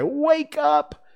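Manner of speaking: very high, loud wake-up call; high, pleading child's voice; child crying out. Acted. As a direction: cry out urgently to a sleeping brother. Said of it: "Michael would cry".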